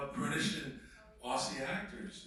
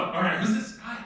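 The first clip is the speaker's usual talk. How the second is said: amazed; 6 semitones higher, 8 dB louder than usual. Retold or imitated. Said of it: "I was watching and going".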